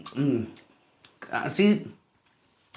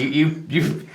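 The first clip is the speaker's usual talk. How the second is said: ordinary delivery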